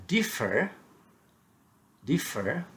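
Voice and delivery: "differ" with the weight on DIF; stress on DIFFER's first syllable. Shown as a demonstration. Teacher showing learners first-syllable stress, DIF in "differ" stressed. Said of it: "if we stressed on D".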